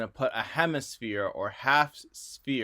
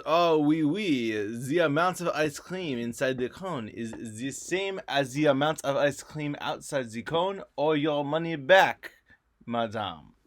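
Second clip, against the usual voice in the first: terrible hammed-up French accent; 2 semitones higher, 3 dB louder than usual. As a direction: ham up a French accent, shopkeeper style. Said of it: "forgive my terrible French accent".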